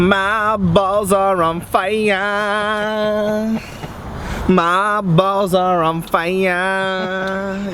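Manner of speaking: drawn-out slow lament; slow mock whine. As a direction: stretch every word in mock agony. Comic complaint after prank.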